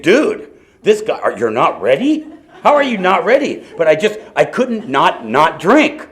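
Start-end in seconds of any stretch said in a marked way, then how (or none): 0.0-3.6 high incredulous onlooker; disbelieving. Retold or imitated. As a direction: sound baffled, pitched up.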